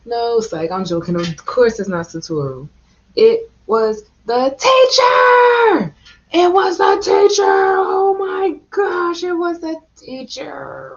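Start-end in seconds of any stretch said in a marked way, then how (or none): 3.1-10.9 shocked shout. Real, not acted. Shocked reveal of the killer.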